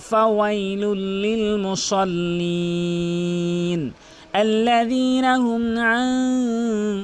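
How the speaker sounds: very slow sustained chant; Quran recitation. Shown as a demonstration. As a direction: melodic tajweed with long held endings. (সুরে টানা ধীর তেলাওয়াত)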